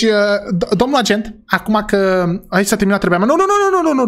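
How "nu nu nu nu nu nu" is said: protesting. Acted. Protesting the ending.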